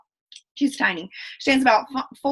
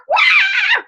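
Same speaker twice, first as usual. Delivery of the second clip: a sudden high, loud whoop of celebration; squealing wah of joy; excited cheer; 5 dB louder than usual. Real, not acted. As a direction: cheer the promotion like a fan in the stands. Cheering a rank promotion.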